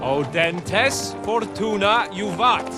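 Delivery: slow, measured Latin for a pupil; slow recital. Acted. Knight teaching his bodyguard Latin, slow recital. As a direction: spell the motto out slowly. Explains the motto after being asked.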